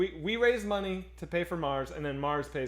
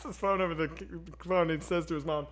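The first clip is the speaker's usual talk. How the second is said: ordinary talk, nothing marked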